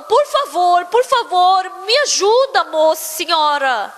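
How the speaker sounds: imitated crying appeal, stretched at the end; mock tearful plea. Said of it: "aquele agiota começou a chorar".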